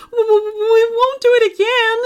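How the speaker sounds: high, fast, stuttering terror; stammering in fear. A narrator's reading. Terrified teens promising the werewolf, high stammer. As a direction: gabble the promise in panic. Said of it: "they chorused".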